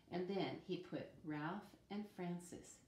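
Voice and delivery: ordinary delivery, nothing marked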